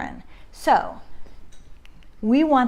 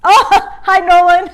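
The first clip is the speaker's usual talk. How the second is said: laughing greeting; 9 semitones higher, 11 dB louder than usual. Real, not acted. Greets child mid-livestream, laughing.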